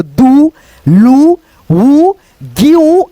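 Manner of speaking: each of "दू, लू, वू, ग्यू" held long on the ऊ; stretched demo syllables "दू, लू, वू, ग्यू", with gaps between; drawn-out long vowels. Shown as a demonstration. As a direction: sound out "दू, लू, वू, ग्यू" slowly, one at a time. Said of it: "इसको कैसे उच्चारण करेंगे".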